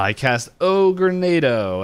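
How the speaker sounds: slow, drawn-out spell announcement; mock-grand spell casting. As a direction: stretch out the spell name like a cartoon villain. Villain casts a spell, retold.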